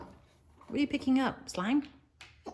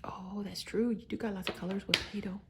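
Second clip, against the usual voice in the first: softly, keeping quiet for sister; low, hushed and gentle; 3 semitones lower, 8 dB quieter than usual. Real, not acted. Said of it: "we can't be distracting".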